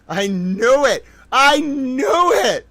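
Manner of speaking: I knew it, stretched out in triumph; excited. Real, not acted. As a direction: savor the win, slow and rising. Triumphant realization.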